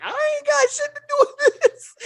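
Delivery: high-pitched protest through a laugh; retold protest. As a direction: plead innocence in a high, laughing voice.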